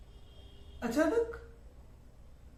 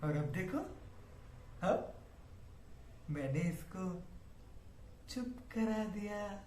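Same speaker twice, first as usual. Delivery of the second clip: low chilling murmur; 5 semitones lower, 11 dB quieter than usual. Acted. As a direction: confide softly, chillingly. Quiet chilling confession. (धीमी, ठंडी फुसफुसाहट)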